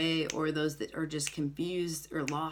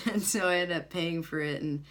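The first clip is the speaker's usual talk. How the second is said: ordinary talk, nothing marked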